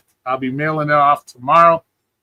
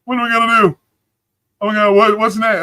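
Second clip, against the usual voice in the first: higher-pitched mimicry of a worrier; mock panic; 4 semitones higher than usual. Streamer imitating everybody worried about being taken, mock panic. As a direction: play up everybody's helpless fretting. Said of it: "Everybody's like".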